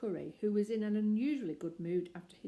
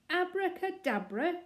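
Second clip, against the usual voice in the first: much higher, louder and slow, furious; raging shout; 11 semitones higher, 7 dB louder than usual. Acted. Mister Curry raging at Paddington over his smashed watch, shouting. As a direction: bellow it beside himself with rage. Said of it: "shouted Mister Curry, beside himself with rage".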